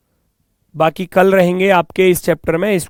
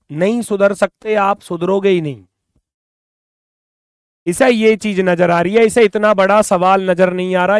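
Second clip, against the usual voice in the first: fed-up teacher raising their voice; exasperated scolding; 3 semitones higher, 6 dB louder than usual. Real, not acted. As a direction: scold a hopeless student, voice raised. Teacher scolds a viewer in comments.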